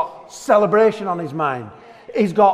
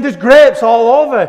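God announcing; 6 semitones higher, 12 dB louder than usual. Retold or imitated. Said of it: "He's saying".

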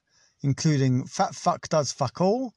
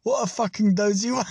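laughing shout; 7 semitones higher than usual. Real, not acted. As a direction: yell it while cracking up.

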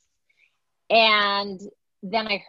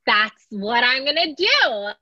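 excited realization; 4 semitones higher, 4 dB louder, slower than usual. Retold or imitated.

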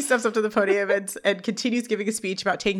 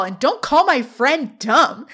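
slow, higher, mock-indignant defense; mild indignation; 4 semitones higher, 4 dB louder, slower than usual. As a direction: stand up for a friend, mildly heated. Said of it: "Sean does not like this".

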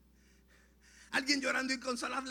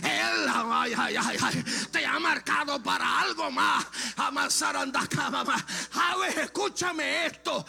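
full-force preaching; 3 semitones higher, 5 dB louder than usual. Acted. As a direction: cry it out to the congregation in a ringing voice.

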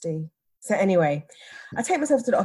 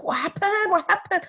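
high-pitched worried team; imitated fretful chorus, pitched way up; alarmed colleagues; 12 semitones higher than usual. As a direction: cry it out high like anxious workmates.

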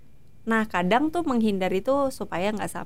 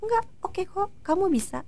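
imitated encouragement; 9 semitones higher than usual. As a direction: coax gently, pitched well up. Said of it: "Adi tuh bantu aku". (membujuk lembut dengan nada tinggi)

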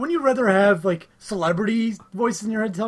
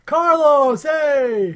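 high, loud mock greeting; pretend calling out; 7 semitones higher, 6 dB louder, much slower than usual. Spotting Carlos in town in January, playful loud hail. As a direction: hail a friend from across the street.

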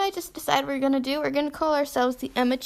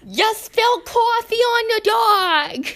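slow, high mock New Jersey; attempted New Jersey accent; 8 semitones higher, 4 dB louder, slower than usual. Shown as a demonstration. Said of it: "is that New Jersey?".

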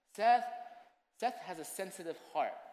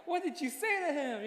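a raised, playful question; playful high question; 9 semitones higher than usual. Retold or imitated. Father teasing his wife about their son, high and playful. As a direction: ask it lightly, half teasing.

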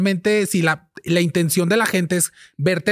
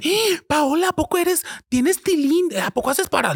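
much higher, shocked gossipy voice; mocking viewers; 10 semitones higher than usual. Imitating live stream viewers asking Paola, high and shocked. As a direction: play the nosy stunned viewer.

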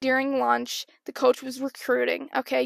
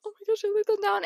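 high-pitched little panicked voice; mock distress; 8 semitones higher, faster than usual. As a kid getting yelled at, high mock distress. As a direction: imitate an upset kid, pitched up. Voicing her upset younger self.

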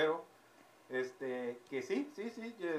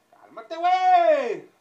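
high, loud repeated pleading; loud calm-down; 11 semitones higher, 12 dB louder, slower than usual. Acted. Waiter loudly telling the customer to calm down. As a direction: shout at him to calm down.

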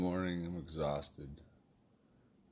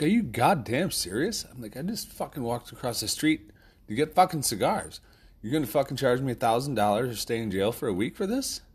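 retold angry rant; 5 semitones higher, 4 dB louder than usual. Ranting at magistrate over charges.

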